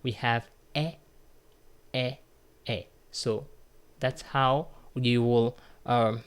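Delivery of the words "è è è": "est" as an open French è; three separate "est" sounds like the e in bet, long pauses between. Shown as a demonstration. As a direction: model "est" as a lone open eh. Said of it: "But for the rest".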